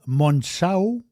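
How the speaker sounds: Monchao said the Portuguese way, rising at the end; playful Portuguese-accented Monchao. Shown as a demonstration. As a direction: give Monchao a light Portuguese lilt. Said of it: "C'est mon petit accent portugais".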